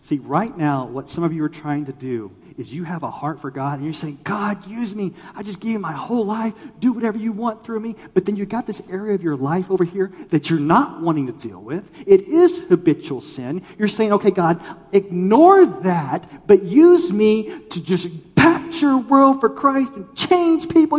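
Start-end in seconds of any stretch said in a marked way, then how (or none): 4.2-7.9 high-pitched, earnest mock plea to God; keen, pitched-up imitation of praying; eager prayer. 14.1-21.0 high, loud, drawn-out mock plea; zealous prayer. Acts out a bargaining, zealous prayer.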